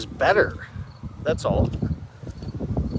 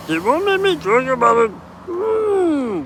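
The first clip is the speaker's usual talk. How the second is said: much higher, thin mocking voice; squeaky mock voice; 11 semitones higher, 4 dB louder than usual. Mocking the people who silence him, high squeaky. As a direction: squeak it out like a cartoon whine.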